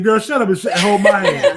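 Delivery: laughing, high and loud; laughing quote. Retold or imitated. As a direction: blurt it out through laughter. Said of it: "I almost said".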